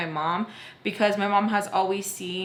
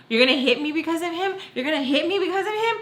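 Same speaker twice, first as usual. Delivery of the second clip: high, fast disbelieving questions; indignant challenge; 8 semitones higher, faster than usual. Retold or imitated. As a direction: re-enact a daughter daring her mom, rising. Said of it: "So I say".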